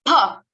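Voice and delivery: फ blown loud through rolled lips; model sound. Shown as a demonstration. Teacher shows students the aspirated ph. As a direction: push फ out hard with the lips rolled. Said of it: "lips रोल करके बोलोगे".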